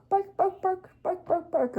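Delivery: high, loud yappy barking; imitated puppy barks. Imitating the puppy barking him awake at five, high yaps. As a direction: bark like a tiny puppy at dawn. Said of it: "the dog".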